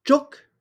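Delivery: Tchouk sharply high, short and loud; Vietnamese chúc. Shown as a demonstration. Teacher models Vietnamese word for class, high sharp tone. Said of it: "on va dire choc".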